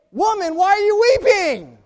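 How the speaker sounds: very high, loud imploring cry; exasperated shout. Acted. Risen Lord to weeping Mary, exasperated shout. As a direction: call it out at full pitch, exasperated.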